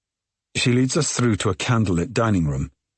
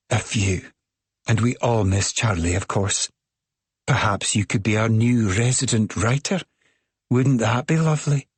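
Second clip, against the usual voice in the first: put-on higher, bubbly thick Scottish accent; 3 semitones higher than usual. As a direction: gush with a thick Scottish lilt. Midge keeps talking.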